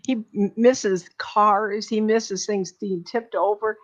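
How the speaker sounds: ordinary talk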